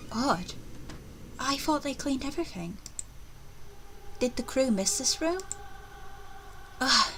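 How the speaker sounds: lightly voiced anxious young woman; growing unease, questions to herself; voice acting uneasy heroine. Reads heroine's game lines.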